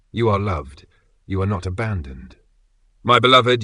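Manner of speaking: normal talking voice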